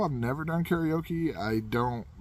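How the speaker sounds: ordinary delivery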